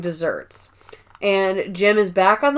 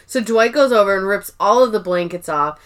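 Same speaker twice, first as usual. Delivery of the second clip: usual speaking voice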